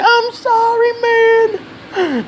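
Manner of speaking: very high squeaky sob-like plea; choked crying bully. Retold or imitated. Bully crying while held by the throat, in a very high squeaky plea. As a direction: squeal sorry like he's near tears. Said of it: "He's like".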